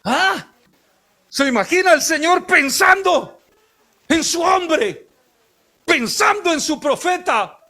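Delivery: aguda y fuerte, con gritos de púlpito; predicación a toda voz. Acted. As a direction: proclama con fervor a la iglesia. (pastor anuncia el cuidado de Dios, predicando a gritos)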